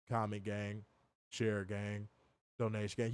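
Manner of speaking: low, soft, flat monotone drone; mock a dull wrestler droning through his intro; Garcia monotone impression. Host mocks Daniel Garcia's promos with a low, soft monotone. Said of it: "imagine if I was like".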